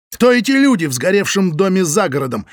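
loud angry shout. A narrator's reading. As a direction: yell it in fury. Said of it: "кричал он".

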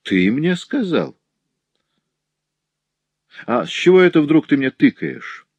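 high-pitched, indignant challenge; menacing exclamation. A narrator's reading. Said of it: "воскликнул пристав, угрожающе надвигаясь". (угрожающе и возмущённо, высоким голосом)

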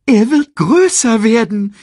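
hoch und laut belehrend; erklärendes Erdmännchen. A narrator's reading. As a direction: Timon stellt es klar. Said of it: "erklärte Timon". (hohe, laute Belehrung)

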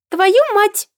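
put-on high, delighted little boy shout; gleeful child voice. A narrator's reading. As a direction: parrot the curse happily like a toddler. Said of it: "радостно повторил Фьюри". (радостный детский выкрик)

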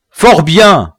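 a chief pronouncing sentence; scornful chief. A narrator's reading. Biassou mocks the refusing colonist, loud and scornful.

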